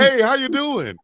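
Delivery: high-pitched, eager mock hello; excited greeting. Instant friendliness on meeting fellow Southerner.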